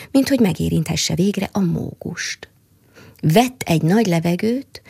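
plain reading voice

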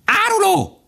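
piercing, very high bird cry; crying magpies. A narrator's reading. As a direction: shriek the accusation from the treetops. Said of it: "kiáltották a szarkák a fákról". (szarkaként élesen kiáltva)

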